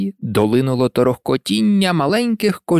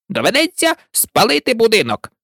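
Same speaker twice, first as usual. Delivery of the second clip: Rabbit's voice; 8 semitones higher than usual. A narrator's reading. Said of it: "долинув голос кролика".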